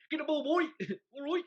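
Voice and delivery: high, loud mock touchline shout; West Country accent. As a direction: yell like a West Country coach. Mocking manager touchline shouts.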